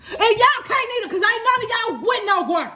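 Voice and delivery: high, loud playful jab; mock shade. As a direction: tease them with mock sass. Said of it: "I'm just playing".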